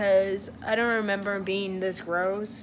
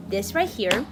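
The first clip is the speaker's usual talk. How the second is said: ordinary talk, nothing marked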